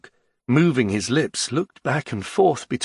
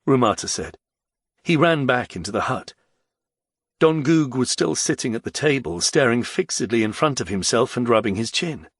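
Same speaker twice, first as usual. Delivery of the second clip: ordinary delivery, nothing marked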